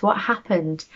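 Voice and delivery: ordinary delivery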